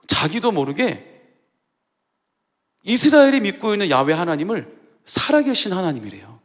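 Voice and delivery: usual speaking voice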